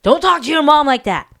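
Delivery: acted fatherly reprimand, loud and firm; stern dad scolding. Father to attitudey boys, mock stern scolding.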